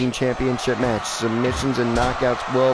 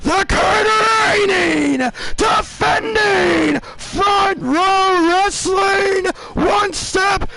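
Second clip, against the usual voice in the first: ring announcer; 4 dB louder, much slower than usual. Acted. Announcer introducing the defending champion, soaring ring announcer voice. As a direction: belt out the champion's titles and name, very drawn out.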